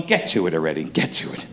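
low, under-the-breath impatience; muttering to himself. Retold or imitated. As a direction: grumble it unvoiced, almost a whisper. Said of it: "I'm going".

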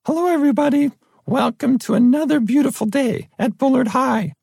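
very high, squeaky teenage announcer imitation. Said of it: "My squeaky voice".

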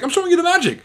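very high, loud retort; high mocking yell. Hitch shouting back at Albert, very high and loud. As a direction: fire it back, shrieking. Mock quarrel after kiss.

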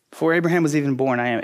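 rattled off quickly; quick recap. Shown as a demonstration. Pastor quickly repeating the verse to the congregation, brisk. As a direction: toss the line off fast as an aside. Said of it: "verse fifty-eight happened".

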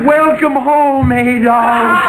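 welcomes him home loud and slow, high and booming; grand welcome shout. Acted. Old man proclaims the homecoming to George, loud and slow. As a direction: trumpet the news like a proud herald. Grand announcement of returned husband.

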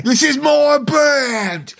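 very high, drawn-out British wail. Acted. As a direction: belt it out high in a parody British accent. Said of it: "impression of [name] doing his impression of [name]".

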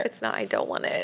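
laughing whisper. Pretend. Joking with co-host.

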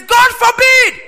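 very high, loud, slow cry of refusal; shout. Acted. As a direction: shout it out as a horrified no. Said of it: "He says".

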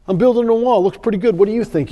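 lightly acted friendly pride; contented bricklayer. Second bricklayer, content, in parable.

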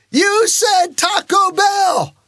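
very high, drawn-out shouted call; yelling out. Retold or imitated. Praying driver yells out the car window, fervent shout. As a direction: re-enact a full-voiced holler, each word stretched. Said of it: "I yelled out the window".

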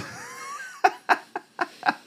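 high-pitched real burst of laughter; squealing laugh. Real, not acted. Game master laughs at his own snorkeling joke, high squeal.

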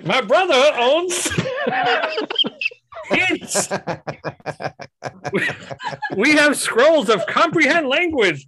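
high, loud merchant listing wares through laughter; hammed-up shopkeeper pitch; laughing merchant voice. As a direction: GM as scroll seller cracking up.

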